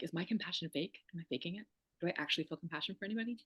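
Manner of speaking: hushed, doubting voice in her own head; self-doubt. Retold or imitated. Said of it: "I'm always like".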